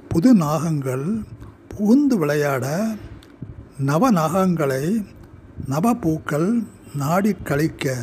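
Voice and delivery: measured poetry reading, pausing after each short phrase; deliberate, declaimed verse with separated phrases; poem recitation. Acted. Poet reads own poem aloud.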